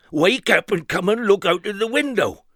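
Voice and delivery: high, eager silly character; high excited voice. Acted. Mister Silly urging Mister Nonsense, high and eager. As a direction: hurry him to the window.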